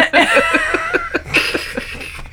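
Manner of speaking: helpless high-pitched laughter; high shrieking laugh. Real, not acted. Friend recalling the bird story, shrieking laughter. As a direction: shriek with laughter you can't stop. Cracking up retelling the scene.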